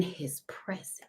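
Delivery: breathy hushed whisper, slowed down. Acted. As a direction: lean in and whisper it slowly, like a secret. Tarot reader hushes on presence.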